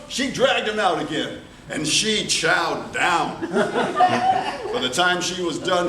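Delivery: plain reading voice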